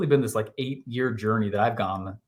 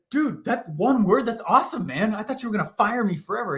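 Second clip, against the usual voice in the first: high, eager relief, words tumbling; excited relief; 10 semitones higher than usual. Retold or imitated. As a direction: blurt it out, giddy with relief. Relieved telling boss his fear.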